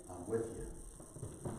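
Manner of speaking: slow, breathless whisper of reassurance. Acted. God reassuring a doubting man, slow whisper. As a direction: let it fall to a slow whisper.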